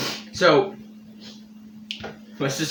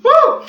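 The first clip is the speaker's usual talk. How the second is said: pained whoop; 9 dB louder than usual. Real, not acted. Burning mouth reaction.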